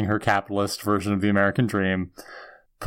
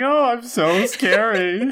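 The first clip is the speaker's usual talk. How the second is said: mock scary; 10 semitones higher, slower than usual. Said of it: "She's like".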